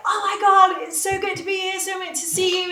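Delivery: high-pitched, gushing greeting; very excited. Retold or imitated. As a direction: burst out with eager excitement. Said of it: "with a very excited energy".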